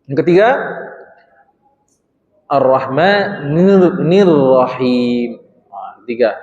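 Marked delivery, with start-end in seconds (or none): 2.5-5.4 Ar-Rahmanir Rahim very slowly, doubled r pressed; pressed tasdid. Shown as a demonstration. Teacher demonstrates the third tasdid to the class, slowly. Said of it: "tekan di situ bunyinya".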